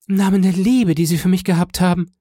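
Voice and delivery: high, loud imploring rush; frantic woman's appeal; desperate plea. A narrator's reading. Wife begging husband.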